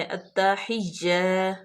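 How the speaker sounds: "التحیہ" very slow with the doubled یا pressed; stretched "التحیہ"; careful recitation. Shown as a demonstration. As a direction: model "التحیہ" slowly. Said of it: "یا جو ہے وہ مشدد ہے".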